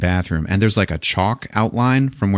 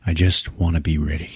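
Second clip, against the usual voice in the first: tag deadpan Stone; 4 semitones lower, 5 dB quieter than usual. Retold or imitated. Stone's deadpan reply to chief.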